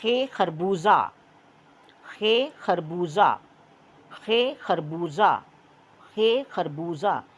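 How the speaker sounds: slow खे खरबूजा with खे stressed; teacher modelling खे खरबूजा slowly for children; slow model reading. Shown as a demonstration. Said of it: "मेरे साथ रिपीट करें".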